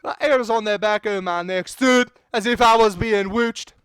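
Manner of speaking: loud, hammed-up Scottish narration; Scottish accent. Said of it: "how to do the Scottish accent".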